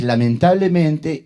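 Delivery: ordinary talk, nothing marked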